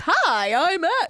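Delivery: exaggerated, very drawn-out "hi"; imitated booming greeting. Teasing how he says hi, drawn-out mock announcer. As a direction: swoop through the greeting in mock announcer style. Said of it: "when people say hi, you do a whole".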